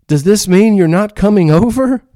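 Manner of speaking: high, loud, desperate punchline; hammed-up pleading squeal; pleading young mom. Young mom begs the stranger on the phone, high and loud. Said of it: "dramatic pause, and the young mom said".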